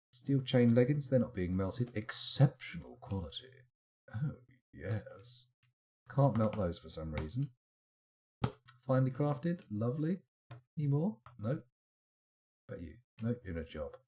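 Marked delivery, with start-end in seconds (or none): none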